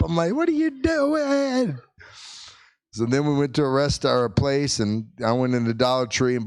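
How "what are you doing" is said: high, drawn-out mock yell; retold mad yelling. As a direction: beg and scold in a stretched high wail. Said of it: "I'm yelling at her. I'm mad at her".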